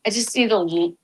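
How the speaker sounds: ordinary delivery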